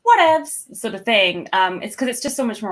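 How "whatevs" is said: self-deprecating. Acted. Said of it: "self-deprecating, like".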